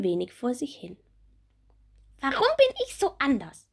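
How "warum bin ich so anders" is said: high, bright little bumblebee; small bumblebee voice. Acted. Little bumblebee Bommel asking Marie about her wings, high childlike voice. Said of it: "will Bommel wissen".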